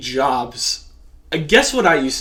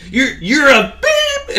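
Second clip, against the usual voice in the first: drawn-out, high beep over the insult; slow down, then beep out the swear; mock self-censoring; 7 semitones higher, much slower than usual. Friend shows how to censor the grandson, mock bleeping. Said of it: "Maybe you should have censored him".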